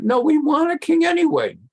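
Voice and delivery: high, insistent mock demand; stubborn people. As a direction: whine it like a crowd that won't listen.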